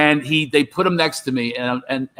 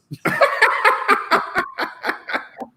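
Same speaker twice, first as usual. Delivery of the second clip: high breathy cackle; wheezing high laugh. Real, not acted. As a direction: burst into a silent wheeze. Laugh after own punchline.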